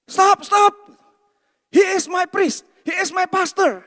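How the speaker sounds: high, loud shout to halt a fight; urgent shouted intervention. Retold or imitated. Young man running to defend his pastor, urgent shouting. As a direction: yell it out in short, high bursts.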